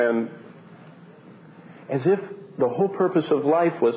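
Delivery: normal talking voice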